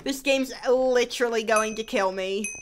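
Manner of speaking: ordinary talk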